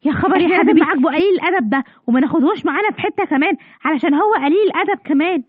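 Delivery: ordinary talk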